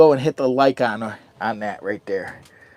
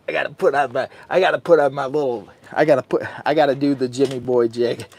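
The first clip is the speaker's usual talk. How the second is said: normal talking voice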